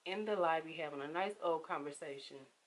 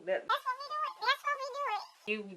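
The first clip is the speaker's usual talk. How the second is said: sarcastic. Retold or imitated. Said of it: "Oh, oh".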